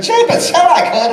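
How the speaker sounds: very high, loud cartoon rabbit voice; Roger Rabbit. Actor showing the voice that got him the job, high cartoon rabbit. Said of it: "if I could do Roger Rabbit".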